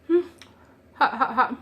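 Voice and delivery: gasping around a hot mouthful; mouth full of hot food. Real, not acted. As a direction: talk through a mouthful of hot cobbler. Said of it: "It's burning".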